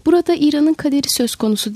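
ordinary delivery, nothing marked

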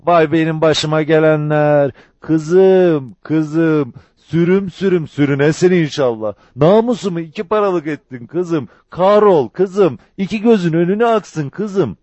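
loud, high mock wailing; fake lament. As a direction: wail out curses in a sham show of grief. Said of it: "Yalancıktan".

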